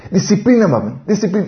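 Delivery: put-on high, eager little girl's voice chanting the word; little girl demanding. Toddler daughter tells her mother off, high and eager. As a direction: play a tiny daughter ordering her mom to be disciplined.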